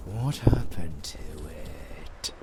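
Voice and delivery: low, slow whisper; slow whispered threat; menacing whisper. Acted. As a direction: drop into a sinister hush. Villain turns to hushed menace.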